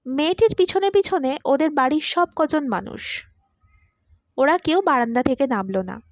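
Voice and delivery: ordinary delivery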